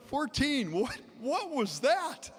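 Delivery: high, slow, astonished question; stunned disbelief. Retold or imitated. Asking his friend about the sudden fire pit blast, high slow astonishment. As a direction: let the shock pull your voice way up. Shocked after the explosion.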